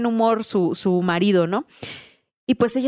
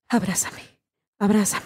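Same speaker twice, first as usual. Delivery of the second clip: trembling plea; 3 dB quieter than usual. Retold or imitated. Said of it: "empieza como a temblar".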